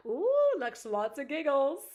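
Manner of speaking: high, loud, drawn-out delight; loud singsong glee; gleeful whoop. Real, not acted. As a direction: draw it out in a big giddy whoop. Gleefully reading a chat comment.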